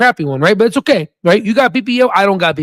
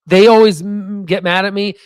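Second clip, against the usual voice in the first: ordinary delivery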